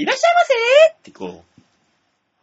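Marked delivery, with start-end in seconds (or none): 0.0-1.0 high, loud clerk greeting; bright loud shop welcome. Acted. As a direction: call out like a fast food cashier. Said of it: "マックのカウンターがあそこにあって".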